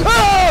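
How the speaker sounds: loud, high startled yell; startled scream. Real, not acted. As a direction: scream as if jumped. Startled by the game.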